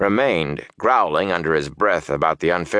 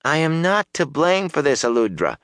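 higher-pitched man defending himself; protesting; 8 semitones higher than usual. A narrator's reading. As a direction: protest that it's not your fault. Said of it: "the man protested".

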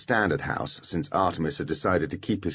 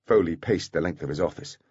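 plain reading voice

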